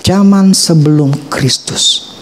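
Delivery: slow emphasis. Real, not acted. Repeated slowly for emphasis.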